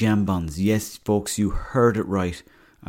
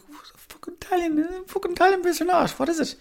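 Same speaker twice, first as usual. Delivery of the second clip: high incredulous outburst. Retold or imitated. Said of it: "I was like".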